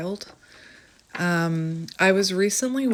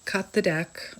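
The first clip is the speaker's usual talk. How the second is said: normal talking voice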